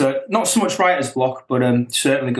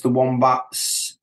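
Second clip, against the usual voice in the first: ordinary delivery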